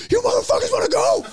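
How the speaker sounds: very high, strained mock shout; hammed-up shriek, daring them to fight; mock crazed challenge. Comic grabbing a knife at his attackers, mock crazed shout.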